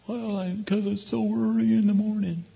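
high-pitched put-on little boy voice; child with attitude. Little boy explaining his morning mood to dad, imitated high child voice. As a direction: play a reluctant child making excuses. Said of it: "attitude on the negative side".

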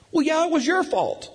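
high put-on kid retort; imitated blaming child. Son blaming dad for smiling, high mock child voice. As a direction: throw blame back like a small boy.

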